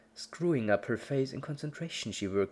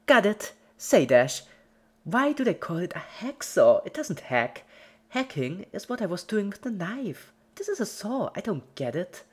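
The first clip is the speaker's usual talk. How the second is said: high, loud, bubbly puzzled chatter; chirpy Pinky; 11 semitones higher, 6 dB louder than usual. Acted. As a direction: wonder aloud like a curious child. Pinky chatters about the hacksaw.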